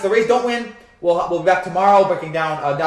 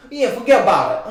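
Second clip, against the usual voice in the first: put-on New York accent; 3 semitones higher, 6 dB louder than usual. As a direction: ham up a half-hearted New York accent. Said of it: "do much of a New York accent".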